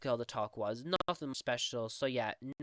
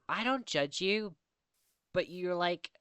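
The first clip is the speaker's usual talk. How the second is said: mock judgy person; 5 semitones higher, 3 dB louder than usual. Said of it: "such a person who judge type of thing to say".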